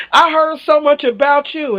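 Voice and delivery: lightly mocking high voice of the date; imitating the date. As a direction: mimic his cheesy line. Date greeting her.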